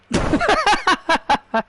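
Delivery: short high cackle at her; scornful giggle; high mocking laugh. Mocking Chelsea's offer.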